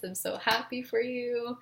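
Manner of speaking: soft, slow, moved congratulations; really emotional. Real, not acted. Reader congratulating viewers on a proposal, really emotional. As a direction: say it tenderly quiet, overcome with feeling. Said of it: "This is making me really emotional".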